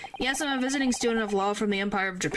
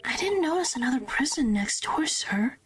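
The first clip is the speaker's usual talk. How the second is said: high whisper; 3 semitones higher than usual. Acted. Character replies to governor.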